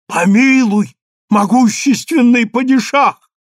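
loud, high plea for mercy; fearful cry. A narrator's reading. Trembling rabbi begs the padishah for mercy, fearful cry. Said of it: "вскричал дрожа раввин".

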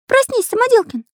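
very high, loud cartoon voice; squeaky Карандаш. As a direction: squeak an urgent wake-up call. Карандаш будит Самоделкина.

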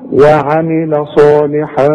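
وعمل صالحا slow, with a nasal hum on the tanween; nasalized recitation. Shown as a demonstration. Teacher recites وعمل صالحا with ghunna. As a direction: hide the نون with a ghunna. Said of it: "هنخفي ونغن".

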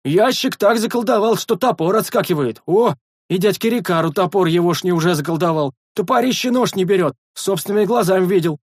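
high, loud excited guard voice; eager sentry. A narrator's reading. The sentry excitedly tells all about the enchanting. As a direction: boast what you saw.

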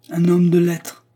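high, loud incredulous echo; loudly mocking repetition; scornful echo. A narrator's reading. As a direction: throw the phrase back in disbelief. Scornful echo of Anna's words.

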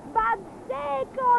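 very high, drawn-out yell; shout with all his breath. Acted. Gobbolino shouting the spell word on the witch's shadow, high yell. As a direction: throw the word out as hard as he can. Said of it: "gridando con quanto fiato aveva in gola".

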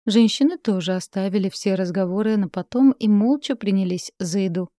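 usual speaking voice

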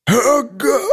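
high strangled cry; desperate cry. A narrator's reading. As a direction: force the last shout out. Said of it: "Хрип Нинсена вышел жалким и жалобным".

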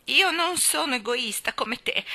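put-on wronged child starting to cry; tearful self-pity. A narrator's reading. Colin sobs into his pillow, self-pitying. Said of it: "mormorò".

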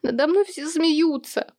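high, slow tearful wail; crying. A narrator's reading. Daughter complaining of being mocked, crying. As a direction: drag it out in a weepy wail. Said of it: "плакала Луи".

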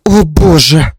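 loud startled cry of fear; frightened exclamation. Acted. Mother-in-law caught by her husband, frightened. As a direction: cry out in alarm. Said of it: "бледнее и дрожа от страха".

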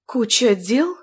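rising, puzzled echo of куча дел; surprised. Acted. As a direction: ask куча дел back in disbelief. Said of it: "удивилась Нинель".

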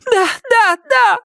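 terrified yes. A narrator's reading. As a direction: give in to him in panic. Myrtle submitting terrified.

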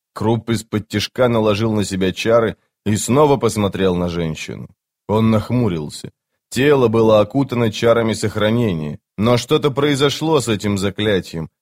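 ordinary delivery

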